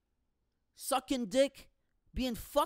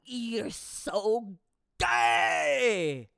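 slow accusing jeer bursting into a shout; voice in his head; 3 semitones higher, 6 dB louder, much slower than usual. Acted. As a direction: draw out the last word, yelling. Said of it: "in my head, it goes".

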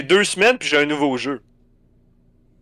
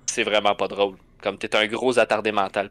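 lower, flat stern cop voice; deadpan scold like an unimpressed cop; 6 semitones lower, 5 dB quieter than usual. Retold or imitated. Police officer telling the gamer it isn't funny, stern.

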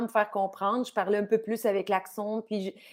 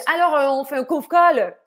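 loud, higher mock announcement; French colleague; 5 semitones higher, 10 dB louder than usual. French guy proposing a phone meeting, loud imitation. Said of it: "un gars qui est arrivé et qui dit".

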